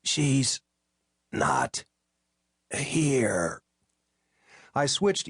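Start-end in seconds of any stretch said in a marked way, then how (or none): 0.0-3.6 fading into a whisper; hoarse whispered. A narrator's reading. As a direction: rasp it, then let it go to breath. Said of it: "raspy and hoarse".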